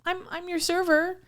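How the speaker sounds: bright, high customer-service voice; hammed-up eager waitress; imitating herself, perky. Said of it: "I'm like".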